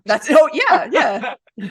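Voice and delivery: slow laughing reply. Real, not acted. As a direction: laugh through it. Laughs at the joke.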